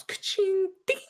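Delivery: high ka-ching sound effect; elevator chime. Acted. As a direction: sing out the chime.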